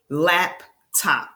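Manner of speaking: "Laptop" broken into two beats; "Laptop" syllable by syllable, for a learner; split into syllables. Shown as a demonstration. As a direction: say "Laptop" as lap, pause, top. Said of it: "let's try some two-syllable words".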